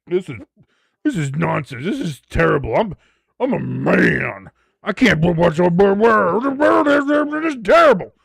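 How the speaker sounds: hammed-up grumbling, swelling to a garbled shout; blustering fake disgust; mock outraged man. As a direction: build from indignant protest to slow, loud bluster. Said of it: "I could be like".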